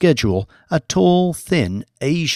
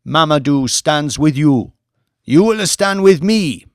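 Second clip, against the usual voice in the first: higher and louder orator's address; rousing rally speech; 4 semitones higher, 5 dB louder than usual. A narrator's reading. Mamadou addressing Red Band protesters, loud rousing oratory. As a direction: stir the oppressed crowd toward their destiny.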